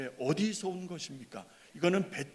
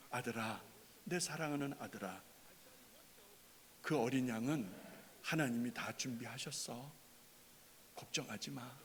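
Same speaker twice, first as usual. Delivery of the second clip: much softer, soothing a frightened son; gently, like a father calming his boy; tender fatherly comfort; 5 semitones lower, 16 dB quieter than usual. Acted. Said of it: "이런 분위기가 아니었을까?". (다정하고 부드럽게 달래듯이)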